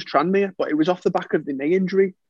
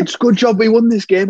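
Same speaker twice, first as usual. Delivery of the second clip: louder and higher, scolding like the manager; retold telling-off; 6 semitones higher, 7 dB louder than usual. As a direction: give the player a dressing-down.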